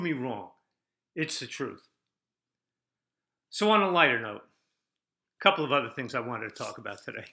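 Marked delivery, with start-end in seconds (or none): none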